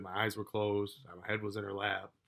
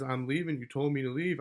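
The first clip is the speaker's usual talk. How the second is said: imitating her protest, higher and louder; defensive retort; 5 semitones higher, 5 dB louder than usual. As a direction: throw the argument back, voice raised. Acted quarrel, her retort retold.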